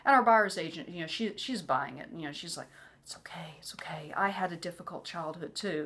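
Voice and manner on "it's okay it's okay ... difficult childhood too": soft, half-whispered reassurance; soothing hush. Retold or imitated. Buyer's agent comforts panicking client, hushed and soothing. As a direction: imitate someone gently comforting, very hushed.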